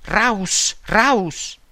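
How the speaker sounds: restrained narrated shout, falling on each word; a storyteller's understated cry; mild shout. A narrator's reading. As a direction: call out each word separately, without full force. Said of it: "si fece avanti gridando".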